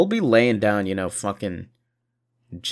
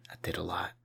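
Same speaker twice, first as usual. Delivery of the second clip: whispered; 3 semitones lower, 11 dB quieter, faster than usual. Real, not acted. Tired aside.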